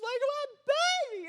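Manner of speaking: high, slow panicked wail of a mother; hammed-up frantic mother's shriek; frantic mom cry. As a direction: cry out for your baby in a mock panic. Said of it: "She's like".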